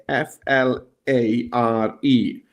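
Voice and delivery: F L A R E, each letter said slowly and apart; spelled out. Shown as a demonstration. Spelling the search word for viewers, slow and letter by letter. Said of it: "search in here for the word flare".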